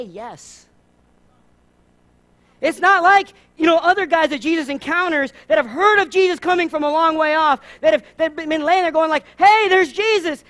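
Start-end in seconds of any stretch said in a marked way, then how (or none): 2.6-10.4 louder and higher, full preaching force; fired-up preaching. Real, not acted. Preacher firing up the congregation, louder and higher. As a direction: lift the room with it. Preaching peak, contrasting eager men.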